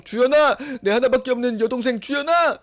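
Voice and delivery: loud, high brotherly call through a door. Acted. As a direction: belt out the name dramatically. (애타게 큰 소리로 부르기)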